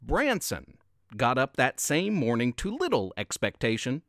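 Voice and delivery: plain reading voice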